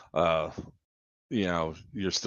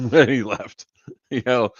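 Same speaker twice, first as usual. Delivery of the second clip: laughing; 4 semitones higher, 6 dB louder than usual. Real, not acted.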